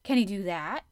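loud, eager question rising at the end. A narrator's reading. Boy asks about the animal charmer, eagerly. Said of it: "he asked eagerly".

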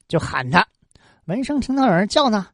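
ordinary delivery, nothing marked

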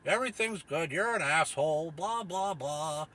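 high, slow smug suit talking down; sarcastic bureaucrat voice; mocking bureaucrat. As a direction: parody an official dismissing you. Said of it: "assholes in the suits being like".

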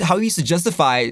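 ordinary delivery

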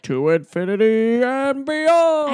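high, loud, drawn-out catchphrase; heroic battle cry. Retold or imitated. Buzz launching himself off, mock heroic cry. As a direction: stretch it into a soaring hero's yell. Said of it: "And he's like".

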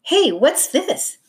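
high-pitched, slow startled question; surprised discovery. A narrator's reading. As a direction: stretch it out in curious surprise. Tom suddenly spots something buried.

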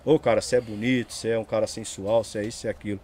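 low, soft mock self-flattery; talking to the mirror. Man acting out praise of himself in the mirror, low and soft. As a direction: murmur mock compliments to your reflection. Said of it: "Olha no espelho e fala de você".

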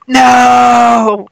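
high, loud cry of no; anguished no. Retold or imitated. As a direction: shout no as a hero falls. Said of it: "my inside voice was like".

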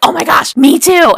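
loud, high mock squeal; excited delight. Tech assistant learning they share a sorority, thrilled. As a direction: imitate gushing at a shared discovery.

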